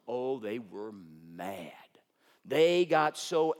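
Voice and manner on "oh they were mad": slow hushed whisper. Acted. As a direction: whisper it like a secret. Dramatic aside in sermon.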